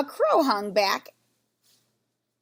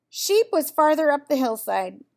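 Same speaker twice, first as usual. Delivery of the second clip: ordinary delivery, nothing marked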